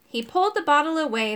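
ordinary delivery

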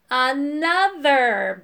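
long, drawn-out bellow. A narrator's reading. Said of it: "bellowed Irk".

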